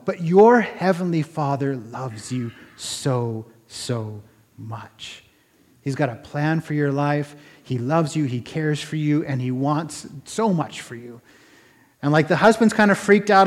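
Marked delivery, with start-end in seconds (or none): 0.0-11.2 slow, soft, lower, heartfelt; tender reassurance. Retold or imitated. Man telling a woman she is loved, tenderly reassuring. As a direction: let it sink in gently, hushed and slow.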